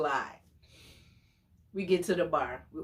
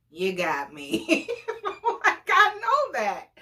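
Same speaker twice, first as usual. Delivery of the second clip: sheepish laugh; 6 semitones higher than usual. Retold or imitated. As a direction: laugh off being caught. Date caught, laughing it off.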